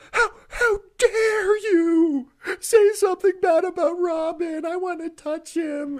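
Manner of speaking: mock sobbing fan. Mocking a fan upset he criticized Robin, hammed-up crying. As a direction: blubber like an offended fanboy. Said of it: "The quivering bottom lip".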